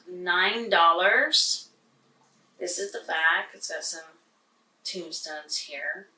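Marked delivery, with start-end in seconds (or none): none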